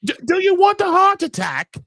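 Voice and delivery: high, loud put-on British accent; a hammed-up British voice asking it; mock British accent. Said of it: "Do you like that British accent?".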